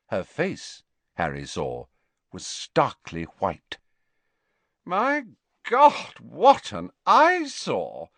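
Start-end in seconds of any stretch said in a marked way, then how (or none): 4.8-8.1 put-on haughty girl. A narrator's reading. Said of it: "rang out a girl's voice".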